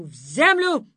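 loud commanding curse; shouted spell. A narrator's reading. Thorn-lady shouting the curse again. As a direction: slam the spell down.

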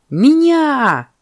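high, loud exclamation; excited monkey. Acted. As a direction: shriek it, volunteering eagerly. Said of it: "подпрыгнула мартышка".

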